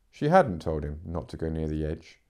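usual speaking voice

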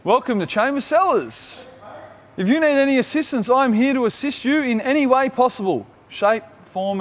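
playing a helpful bottle shop clerk; mock shop assistant greeting. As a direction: put on a chirpy shop-worker greeting. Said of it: "I think that's how you say it".

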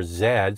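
Z said as zed; the letter Z said zed, the British way; Canadian zed. Shown as a demonstration. Host shows the Canadian letter Z, said zed. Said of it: "we speak the King's English".

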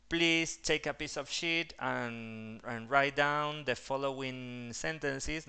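"Please take a piece of sheet" spelled out slowly, each word clear; slow model sentence. Shown as a demonstration. Teacher modelling a classroom request slowly. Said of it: "si vos tenés que decir".

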